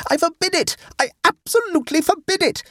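furious, very high-pitched prohibition; high, emphatic tantrum; storming. A narrator's reading. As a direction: storm at him, shrill with outrage. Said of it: "stormed Adela".

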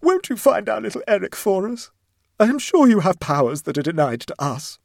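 high, loud, tearful begging; sobbing plea. A narrator's reading. Mother begs the neighbour to find her son, sobbing. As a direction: implore through theatrical sobs. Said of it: "she sobbed".

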